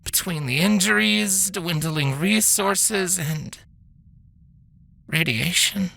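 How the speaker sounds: a worn-out doctor sighing through bad news; weary mare doctor. Voices the doctor.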